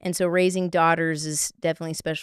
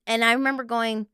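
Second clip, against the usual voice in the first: ordinary talk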